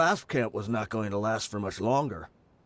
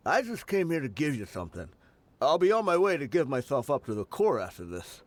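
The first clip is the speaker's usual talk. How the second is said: ordinary talk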